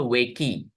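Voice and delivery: वेकी with the silent e sounded as "ee"; wrong two-syllable reading. Shown as a demonstration. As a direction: misread wake as two beats, वेकी. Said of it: "हम ऐसा नहीं बोलते हैं".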